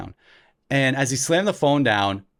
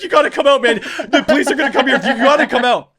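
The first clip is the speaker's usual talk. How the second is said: high, loud, laughing urgent plea; hammed-up alarm with laughter creeping in; mock urgency; 11 semitones higher, 7 dB louder, faster than usual. Brother urging a child out from under the bed, mock urgency.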